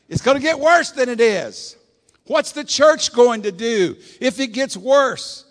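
loud, rising pulpit shout, slow on the world passing; fiery sermon climax; preaching at full force. Acted. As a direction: thunder it at the congregation.

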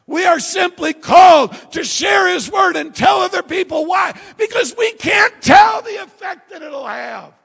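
loud, high-pitched sermon delivery; full-force preaching. Real, not acted. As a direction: proclaim it forcefully to the congregation.

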